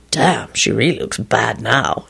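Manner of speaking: low, slow, impressed remark. A narrator's reading. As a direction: marvel slowly in a low voice. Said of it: "added in an impressed voice".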